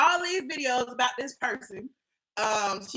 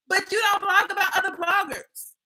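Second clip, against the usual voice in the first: mocking retort; 6 semitones higher, 4 dB louder than usual. Mocking the woman's claim.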